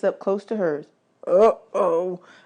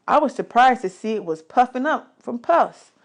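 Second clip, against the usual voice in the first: usual speaking voice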